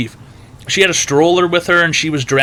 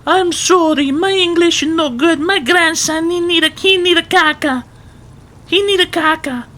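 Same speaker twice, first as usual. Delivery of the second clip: much higher, broken-English Eastern European granny voice; Eastern European granny accent. Retold or imitated. Grocery worker mimics a babushka asking for the toilet, high Eastern European accent. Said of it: "so she didn't speak English".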